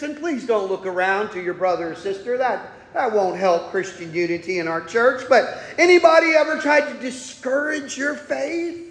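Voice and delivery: ordinary talk, nothing marked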